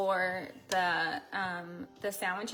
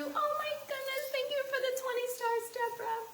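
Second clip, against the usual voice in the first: excited thanks; 14 semitones higher, faster than usual. Real, not acted. Thanking viewer for live gift.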